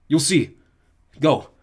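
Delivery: loud, curt command; firm order. A narrator's reading. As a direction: send them away. Beckendorf sending them off.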